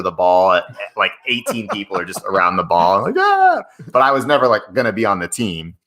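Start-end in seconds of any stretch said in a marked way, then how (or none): 3.2-3.6 high, loud "ah"; excited kid yell. Acted. Guest imitating kids swarming the soccer ball, excited yell. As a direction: squeal like a kid chasing a ball.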